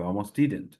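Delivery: ordinary talk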